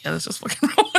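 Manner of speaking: quoted line broken by a laugh; laughing mock player. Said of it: "Craig is so willing to be like".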